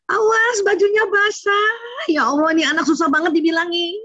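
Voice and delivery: high scolding nag; a mother nagging her kid about wet clothes. Retold or imitated. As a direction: scold the child like an exasperated mother. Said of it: "Gitu ya kan".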